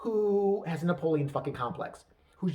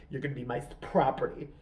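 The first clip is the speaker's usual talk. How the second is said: low, hushed commanding voice; mock dominant lover; 5 semitones lower than usual. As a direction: murmur the orders like a hammed-up seducer. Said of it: "he was gonna be like".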